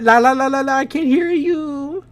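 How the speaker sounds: put-on singsong kid voice; childish taunt. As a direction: taunt like a schoolkid. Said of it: "she's like a kid".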